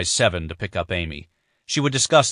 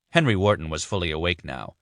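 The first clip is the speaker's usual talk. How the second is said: ordinary delivery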